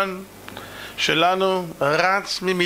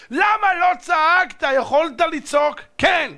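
high, drawn-out accusing question; impassioned preacher's cry; shouted reproach; 10 semitones higher than usual. Acted. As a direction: demand an answer at full force. God demands why no cry came.

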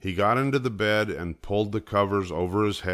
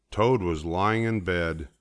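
ordinary delivery

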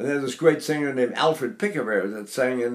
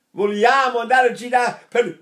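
lively Italian phrase, louder; Italian speech; 7 semitones higher, 5 dB louder than usual. Retold or imitated. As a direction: say it like a native Italian. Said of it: "an Italian who's saying".

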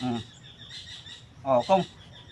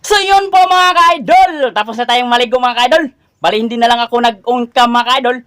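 higher, louder upbeat sign-off to fans; vlogger sign-off; 4 semitones higher, 8 dB louder than usual. Acted. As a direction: cheerfully wrap up for the viewers. Vlog announcement to viewers. (masiglang pamamaalam sa mga manonood)

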